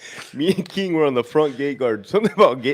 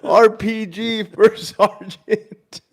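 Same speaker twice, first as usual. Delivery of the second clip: high, stretched-out retold alarm call; mock yelling; 7 semitones higher, 4 dB louder, much slower than usual. Soldier warning his first sergeant of incoming, mock yelling. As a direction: draw the warning out like a comic yell.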